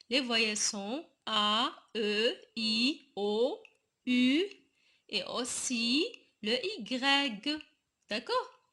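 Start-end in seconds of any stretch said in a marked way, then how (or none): none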